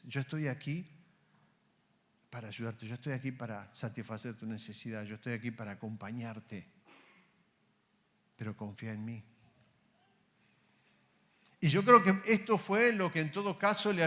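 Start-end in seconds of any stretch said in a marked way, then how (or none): 0.0-9.2 soft, lower comforting voice; tender promise. Acted. God promising Ernesto to stay with him, soft and tender. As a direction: reassure him he is not alone.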